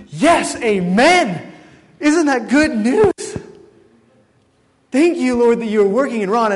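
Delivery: loud, high, exultant outburst; jubilant praise. Retold or imitated. Preacher reliving his thanks to God for working in Ron, jubilant. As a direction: celebrate at full volume with stretched words. Rejoicing at Ron's grasp of Scripture.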